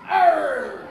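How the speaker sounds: loud growling pirate Arr. Shown as a demonstration. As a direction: bark it like a salty pirate. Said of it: "a pirate says".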